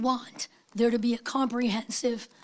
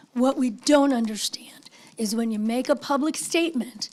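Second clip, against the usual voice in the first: raspy whisper. Real, not acted. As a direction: voice gone to a whisper. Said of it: "I have no voice".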